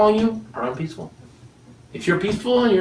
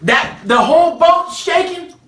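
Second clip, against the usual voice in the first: high, slow, awed telling; hushed then lifted wonder; shaking boat; 10 semitones higher, slower than usual. Acted. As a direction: paint the rocking boat. Storm scene, describing shaking boat.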